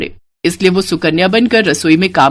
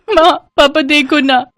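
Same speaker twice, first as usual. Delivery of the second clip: slow, higher, sobbing माँ पापा देखो ना; crying child; 6 semitones higher than usual. Acted. Little Sudha crying to her parents over her sister's teasing. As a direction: wail it through tears. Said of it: "ये सुनकर सुधा हमेशा रोती थी".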